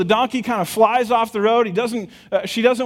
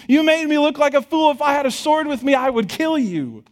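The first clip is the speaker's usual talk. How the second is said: raised, higher-pitched mock fury at the donkey; a preacher hamming up an enraged man; angry outburst; 8 semitones higher than usual. Balaam yells at his donkey, hammed-up fury. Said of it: "Balaam is so upset in this moment".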